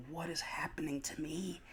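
hushed, voiceless mock disbelief; bewildered whisper. Expectant father retelling his emotional shock, bewildered whisper. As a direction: whisper it as if stunned by yourself.

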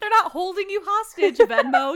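loud, high outburst through laughter; laughing, exasperated shout; mock berating. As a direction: yell at him in amused disbelief. Mock berating of clueless John.

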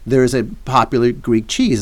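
ordinary talk, nothing marked

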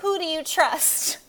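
teasing announcer voice; mock poll voice. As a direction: hype the cheesy online poll. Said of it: "stupid giant Facebook polls".

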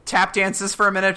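ordinary talk, nothing marked

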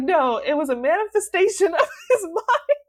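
high, loud mock protest breaking into laughter; laughing insistence. Retelling a debate with a friend, insistent and laughing. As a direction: insist emphatically, cracking up midway.

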